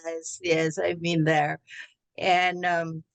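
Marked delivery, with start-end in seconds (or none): none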